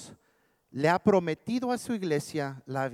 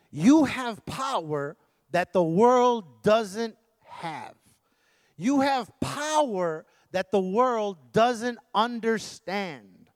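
slow, weighty proclamation; drawn-out pulpit declaration; emphatic preaching; 4 semitones higher, 5 dB louder, much slower than usual. Acted. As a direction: stretch each phrase out for the congregation.

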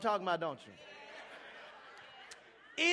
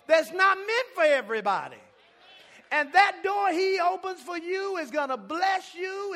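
loud, rising preacher's call; preaching; 4 semitones higher, 6 dB louder than usual. Acted. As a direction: preach it to the crowd. Preaches at full force.

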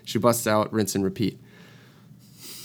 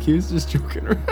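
laughing through it; 7 semitones higher than usual. Retold or imitated. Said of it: "you were like".

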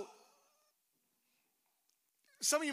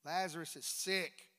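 ordinary talk

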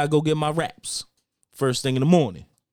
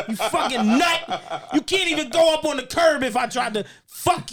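retold road rage yelling; 5 semitones higher, 4 dB louder than usual. Retelling road rage at other driver.

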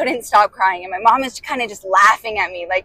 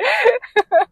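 high giggle; 13 semitones higher than usual. Real, not acted. Laughing at crying story.

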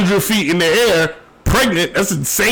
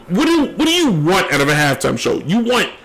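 usual speaking voice

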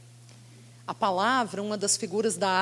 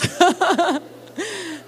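laughing; 7 semitones higher than usual. Real, not acted.

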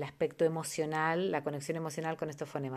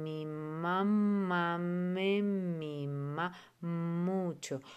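very slow, syllable by syllable; much slower than usual. Shown as a demonstration. Teacher showing how slowing speech helps children, very slow. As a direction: stretch out each sound of "Mi mamá me mima mucho". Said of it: "la ralentización".

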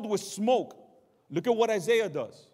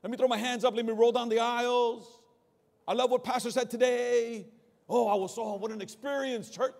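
higher, bubbly mock excitement; mocking giddy churchgoer; 5 semitones higher than usual. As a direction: parody the shallow churchgoer. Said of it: "it's like".

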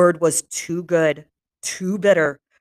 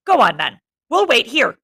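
louder, higher angry retort; enraged; 4 semitones higher, 5 dB louder than usual. Acted. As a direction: snap back in rage. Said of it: "fear enraged him".

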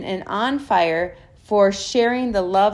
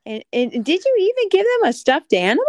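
excited; 10 semitones higher, 6 dB louder, faster than usual. Acted. Excited question to niece.

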